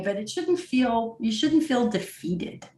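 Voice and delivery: ordinary talk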